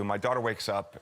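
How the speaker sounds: ordinary talk, nothing marked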